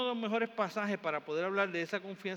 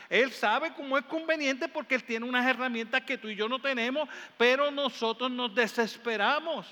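louder, higher impassioned preaching; fiery preaching; 5 semitones higher, 5 dB louder than usual. Acted. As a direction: preach it out with fire. Preaching at full force. (predicación con fuerza y pasión)

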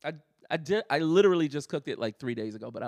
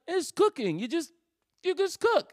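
a much higher, airy mock dad tossing it off; imitating a breezy dad; 13 semitones higher than usual. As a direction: play the dad as if it's obvious. Son imitates dad's casual advice.

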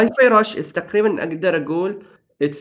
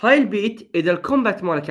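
usual speaking voice

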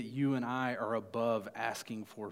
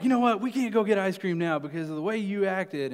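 loud, raised-pitch retold scolding; frustrated parent; 7 semitones higher, 8 dB louder than usual. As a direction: imitate a fed-up dad laying down the law, loudly. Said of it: "I got so frustrated with it".

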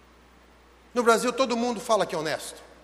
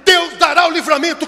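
loud, high, forceful exhortation; fervent pulpit shout; impassioned preaching; 4 semitones higher, 7 dB louder than usual. Acted.

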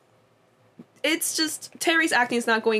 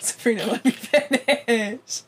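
amused exasperation. Real, not acted. Pleading with interrupting co-host to stop.

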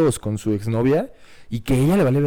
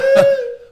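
high burst of laughter; 7 dB louder than usual. Real, not acted.

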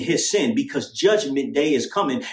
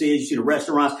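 ordinary delivery

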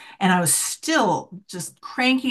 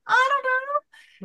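playful shrug; slower than usual. Real, not acted. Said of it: "naughty shaman".

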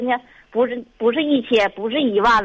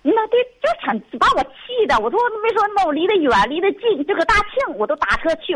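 real anger; 7 semitones higher than usual. Real, not acted.

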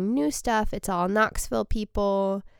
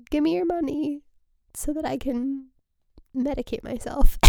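higher, whiny mock plea through half-tears; mock tearful pleading; 6 semitones higher than usual. Retelling her laughing fit to listeners, a mock tearful plea. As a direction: play it up as a helpless wail. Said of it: "And I'm like".

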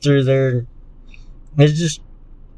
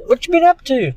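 high-pitched; 12 semitones higher than usual. Retold or imitated. Mock greeting on the toilet.

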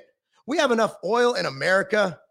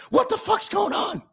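much higher, yelled; exasperated yell; 11 semitones higher than usual. Real, not acted. Fed up with the news, exasperated high yell. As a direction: throw up your hands and yell it. Exasperated outburst about the dude.

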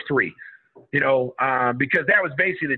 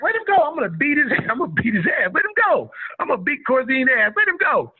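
retold yell; 9 semitones higher than usual. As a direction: bellow to be let loose at the other guy. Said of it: "I'm yelling Corzine the whole time".

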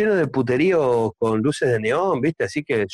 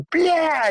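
smash sound; 13 semitones higher than usual. Acted. Joke: plate smashed on wall.

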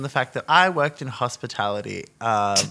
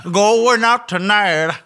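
camp slow drawl; 9 semitones higher, 7 dB louder, much slower than usual. Retold or imitated. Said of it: "I'm like".

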